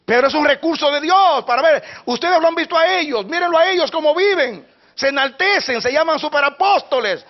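loud, high, impassioned preacher's cry; fiery pulpit shouting; full-force preaching. Acted. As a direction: preach it out at the top of the voice.

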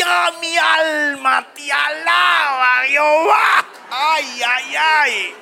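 high, drawn-out wail of praise through mock pain; hammed-up pained praise. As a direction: yelp it out like you just stubbed a toe. Se golpea las uñas al tropezar. (alabanza gritada con dolor fingido)

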